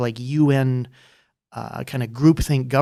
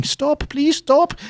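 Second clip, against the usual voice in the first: much higher, slow, hammed-up plea; mock pleading cops; 14 semitones higher, 4 dB louder, much slower than usual. Austrian police pleading with crowds, high slow mock plea. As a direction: squeak it out like a helpless Keystone Cop.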